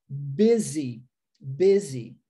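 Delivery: busy said bizzy with a buzzing Z. Shown as a demonstration. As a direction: stress the Z in busy. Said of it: "S com a pronúncia de Z".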